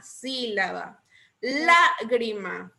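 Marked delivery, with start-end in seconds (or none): 1.4-2.7 Lágrima slowly with a punched LÁ; Lágrima, slow, opening syllable emphasized; stress on Lágrima's first syllable. Shown as a demonstration. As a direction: hit LÁ, then let grima fall in Lágrima. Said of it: "Esdrújula".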